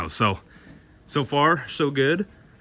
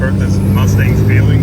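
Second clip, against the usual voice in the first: loud over engine; 7 dB louder than usual. Real, not acted. Shouting over engine on freeway.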